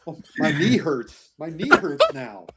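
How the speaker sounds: talking through laughter; helpless laughter breaking into the words; laughing. Real, not acted. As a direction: tease while cracking up. Cracking up at the bad accent.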